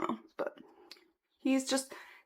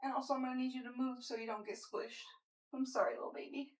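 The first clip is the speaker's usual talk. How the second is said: talking to the pet; 3 semitones higher, 10 dB quieter than usual. Real, not acted. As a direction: coo gently to the cat. Talking softly to her cat.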